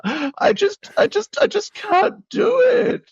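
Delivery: hammed-up anxious breakdown; imitating himself crying in a message; mock crying. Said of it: "something being like".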